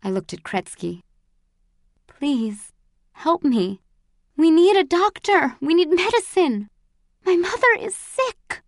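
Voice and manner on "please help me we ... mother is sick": plea rising high and loud; urgent, high-pitched begging; desperate pleading. A narrator's reading. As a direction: beg for help, climbing into desperation. Prisoner begs guard for sick mother.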